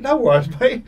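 high, amused, laughing; laughing shrug-off. Retold or imitated. Player laughing off an angry fan's threat, amused.

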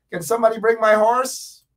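high, loud playful call for a horse; mock calling out. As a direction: call out grandly as if summoning a servant. Jokingly calls for his horse.

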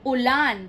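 "Ulan" with loud LAN; u-LAN, weight on the end of "Ulan"; stress on last syllable. Shown as a demonstration. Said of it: "The stress is at the last part".

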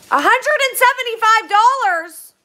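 loud, high, real astonishment; shocked disbelief. Real, not acted. Streamer seeing a huge super chat, shocked. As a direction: cry out the figure in amazement.

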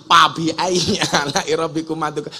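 "Fabi" said as pabi, F turned to P; Sundanese accent. Shown as a demonstration. Preacher mimicking a Sundanese reciter who says pabi for Fabi.

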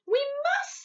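high, loud insistence; insistent cry. A narrator's reading. Sophie pressing the reluctant giant, insistent cry. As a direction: cry it out, pitched right up. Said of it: "Sophie cried".

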